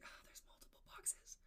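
soft surprised whisper; whispered aside. Retold or imitated. Customer noticing multiple boxes at the counter, whispered. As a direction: whisper it under your breath. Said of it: "And I was like".

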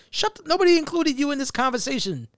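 high, acted put-down of an intruder; irritated rebuke. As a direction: snub someone who chimed in. Acts rebuke of someone chiming in.

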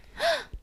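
high imitated gasp. Mocking her delayed answer to Bella's proposal, gasp. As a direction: mimic a sudden gasp. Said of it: "And then she gasps".

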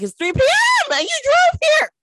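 incredulous. Retold or imitated. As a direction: squeak it out in shock. Disbelief at drunk client driving.

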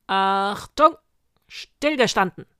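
loud parade-ground shout; grim drill command. Acted. Sergeant to his witch guard, loud grim command. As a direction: call the troop sharply to attention. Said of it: "musterte grimmig seine Garde".

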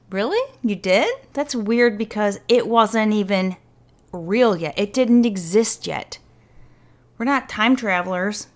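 mock them with fake surprise; sarcastic. Real, not acted. Mocking police iPhone claim.

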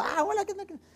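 very high, sing-out hello; high-pitched greeting. Acted. Pastor greeting the widows who spotted him, high-pitched greeting.

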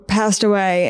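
normal talking voice

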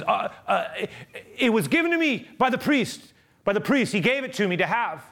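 high-pitched stammering alibi, mock panic; flustered protest. Caught thief making excuses to guards, flustered protest.

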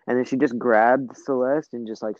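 ordinary talk

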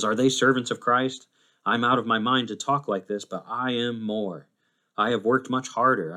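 forceful, driving recitation of hardships; impassioned scripture reading. Acted. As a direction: declaim Paul's list with mounting insistence. Declaims Paul's list of hardships.

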